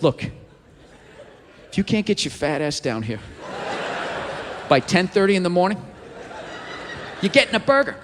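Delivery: stare down the camera and threaten; mock clown menace. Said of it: "he just looks right in the camera and just goes".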